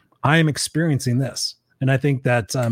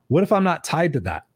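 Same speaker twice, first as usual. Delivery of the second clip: normal talking voice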